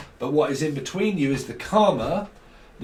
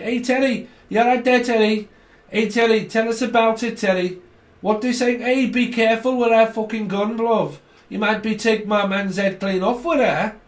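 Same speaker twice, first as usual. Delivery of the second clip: high, louder Scouse patter; Scouse accent; 8 semitones higher, 5 dB louder than usual. Shown as a demonstration. Warning Teddy about that gun, high put-on Scouse. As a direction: rattle on as an excitable Scouser, pitched up. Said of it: "Bit like that".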